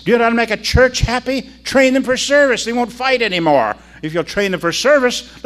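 loud, high-pitched pulpit exhortation; rousing preaching. Real, not acted. Preacher urging churches to train for service, rousing. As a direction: drive it home to the congregation.

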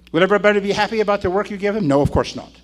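ordinary delivery

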